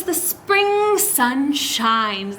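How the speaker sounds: very slow reread; reading to children. Acted. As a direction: savor each word for the children. Rereads line slowly.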